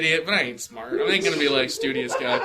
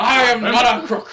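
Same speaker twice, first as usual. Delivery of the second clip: slow, laughing politician impression; Nixon impression; 5 semitones higher, 5 dB louder, slower than usual. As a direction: ham up the presidential denial. Famous quote done as an impression.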